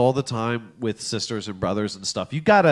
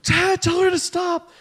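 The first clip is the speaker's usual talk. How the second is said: high-pitched mock whine to dad; high, drawn-out tattling cry; mock kid's yell; 3 dB louder, slower than usual. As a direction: imitate a child calling out in protest. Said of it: "just loud enough".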